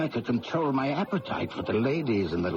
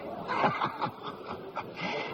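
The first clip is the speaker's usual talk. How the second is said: a soft breathy chuckle at himself; quiet wry laugh; rueful laugh; 6 dB quieter than usual. Acted. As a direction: laugh off your own good fortune. Laughs at his own luck.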